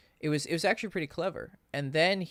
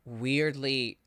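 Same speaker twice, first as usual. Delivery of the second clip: normal talking voice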